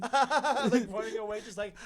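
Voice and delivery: high laughing while running off; giddy panicked laughter. Retold or imitated. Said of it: "panic laughing".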